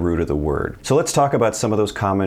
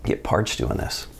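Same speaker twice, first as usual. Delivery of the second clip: muttered aside; 4 semitones lower, 4 dB quieter than usual. Real, not acted. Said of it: "Let me get a little coffee".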